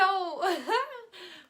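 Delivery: giddy high laugh; frantic laughter. Acted. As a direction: laugh until lightheaded. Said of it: "George's laughter grew frantic".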